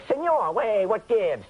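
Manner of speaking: high, loud New York wise guy; cocky kid from Queens; Queens accent. Retold or imitated. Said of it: "That's the way we talk".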